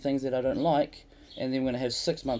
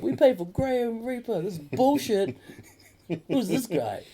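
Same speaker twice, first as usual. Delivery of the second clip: higher, mock indignant crowd voice; angry crowd; 11 semitones higher than usual. Crowd wanting Graham, high mock complaint. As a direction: complain like a cheated punter. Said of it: "They're like".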